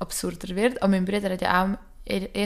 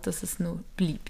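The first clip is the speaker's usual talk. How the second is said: ordinary talk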